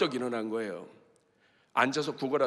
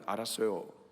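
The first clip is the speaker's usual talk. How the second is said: meek compliance; 5 semitones lower, 6 dB quieter than usual. Acted. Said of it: "잠잠하라고 하니까".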